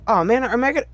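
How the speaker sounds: ordinary talk, nothing marked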